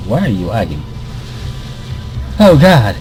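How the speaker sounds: ordinary talk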